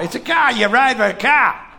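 put-on loud, high, exasperated Boston accent. Bostonian explaining to a baffled listener, loud Boston accent.

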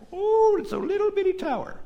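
high-pitched mock marveling; sarcastic. Preacher voicing God mocking the tower, sarcastic. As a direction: coo over it with mock wonder. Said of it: "there's interesting sarcasm there".

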